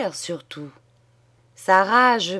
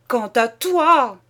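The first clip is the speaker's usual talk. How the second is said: louder and higher, slow, voice straining; higher shout; 5 semitones higher, 6 dB louder, slower than usual. Acted. Husband repeating at his wife, higher straining shout. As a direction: push it up, almost breaking. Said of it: "il cria".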